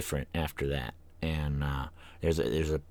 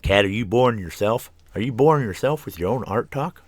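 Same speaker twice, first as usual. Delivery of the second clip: higher, louder teasing self-interrogation; mock questioning; 6 semitones higher, 7 dB louder than usual. Host teasing themself about their own art talk, mock questioning. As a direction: put yourself playfully on the spot.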